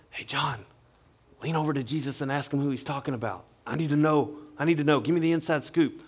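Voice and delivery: soft, conspiratorial undertone, urgent at the end; hushed aside. Acted. Peter secretly asks John to find out, in a hushed aside.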